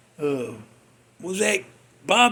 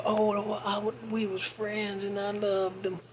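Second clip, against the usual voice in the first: mock mourning; 11 dB quieter than usual. Mocking late funeral praise.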